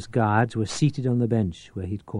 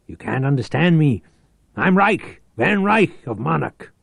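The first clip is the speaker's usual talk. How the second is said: higher, louder insistent shouting; 6 semitones higher, 6 dB louder than usual. Acted. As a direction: shout to force a deaf man to hear. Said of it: "Reich shouted".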